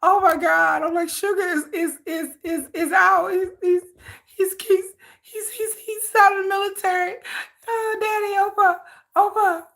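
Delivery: high, teary, breathless excited squeal with a catch; imagined overjoyed squeal. Pretend. Fan squealing as her idol leaves the military, high and teary. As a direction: squeal and half-cry with joy at the good news. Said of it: "I'll be like".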